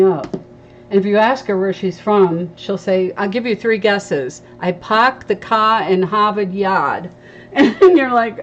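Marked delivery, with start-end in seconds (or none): 3.2-7.1 imitating a strong Boston accent; drawn-out mock Boston accent. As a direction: do a friend's Boston accent, R's dropped. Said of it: "that strong Boston accent".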